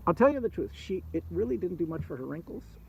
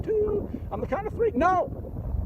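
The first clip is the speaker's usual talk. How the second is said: very high panicked no; shrieking dragon; 3 dB louder, slower than usual. Acted. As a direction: shriek the count and the no.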